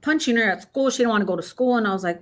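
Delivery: ordinary talk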